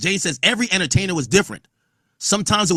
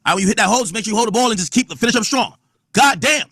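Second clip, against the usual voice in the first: football coach hype; 6 semitones higher, faster than usual. Retold or imitated. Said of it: "It's like a football coach".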